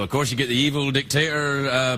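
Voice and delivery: ordinary talk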